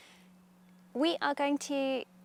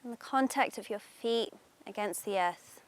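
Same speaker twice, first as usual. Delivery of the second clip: guided meditation; 3 dB louder than usual. Acted. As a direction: ease them into calm, unhurried.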